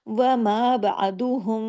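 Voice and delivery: slow, drawn-out tajweed chant; Quranic recitation. Shown as a demonstration. Teacher reciting a verse for students, slow chant. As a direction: recite it slowly with full tajweed.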